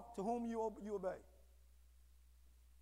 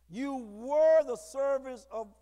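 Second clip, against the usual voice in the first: slow, loud reading; 9 dB louder, much slower than usual. Shown as a demonstration. Emphatic scripture reading.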